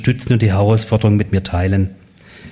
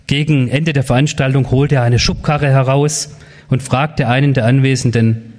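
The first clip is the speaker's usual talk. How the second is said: ordinary delivery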